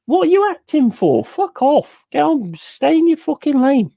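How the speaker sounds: high, heated telling-off; mock-angry rant. Real, not acted. Streamer scolding pop stars who act, high heated rant. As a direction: tell them off, voice up high. Ranting at celebrities acting.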